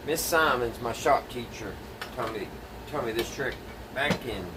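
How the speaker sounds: ordinary delivery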